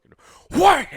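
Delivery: a sudden loud burst on wham; loud mock impact; shouted wham. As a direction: slam the word out like the book hitting. Reenacting slamming book on head.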